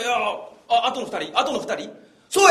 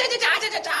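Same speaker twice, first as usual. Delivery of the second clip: high giggly kung fu cry; Jackie Chan yell; 11 semitones higher, much faster than usual. Pretend. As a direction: laugh through a movie fighter's yell. Jackie Chan kiai laughing.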